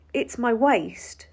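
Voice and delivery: slow, loud, each word spelled out; slow exasperated explaining. Retold or imitated. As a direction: explain it slowly as if to a child.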